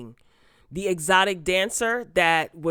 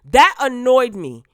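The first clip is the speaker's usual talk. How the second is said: louder, slow and pointed, really irritated; fed up, voice raised and deliberate; annoyed, raised voice; 4 semitones higher, 9 dB louder than usual. Real, not acted. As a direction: lean hard into the annoyance. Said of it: "my voice is getting elevated".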